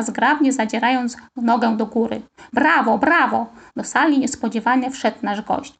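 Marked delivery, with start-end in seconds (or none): 2.5-3.5 a different adult voice cheering brava; visiting dancer praises the children on entering; guest's cheer. Acted. Guest enters applauding the kids. (inny głos, wesołe brawo)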